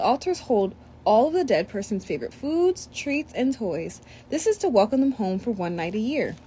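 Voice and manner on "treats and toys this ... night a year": ordinary delivery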